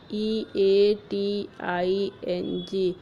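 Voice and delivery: slow, letter by letter; spelling out letters. Shown as a demonstration. Teacher spelling eating for the class, slow. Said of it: "but the word eating".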